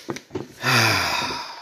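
long breathy sigh; heavy sigh. Acted. Man whose lawn another mowed, heavy sigh. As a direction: let out a long exhale. Rueful pause after neighbor story.

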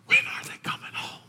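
hushed, aching whisper; longing whisper. Acted. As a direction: whisper it like a father waiting up. Voices God longing for children.

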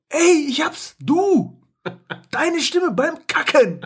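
high, eager eureka voice, slowing at the end; mock colleague, high and thrilled; excited idea. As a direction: blurt out the brilliant idea in a high voice. Acted ad makers in toilet.

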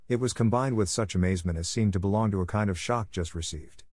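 ordinary delivery

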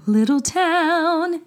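very high, slow sung-out princess; dreamy Disney princess; cartoon princess. Shown as a demonstration. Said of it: "And it was like".

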